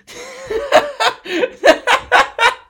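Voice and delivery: loud squealing laugh; high burst of laughter. Real, not acted. Laughing at NFT story.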